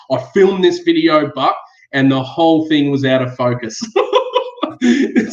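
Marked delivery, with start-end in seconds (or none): 3.8-4.8 a sudden high, breathless laugh; embarrassed squeaky laugh; high airy laugh. Real, not acted. Laughs at out-of-focus video.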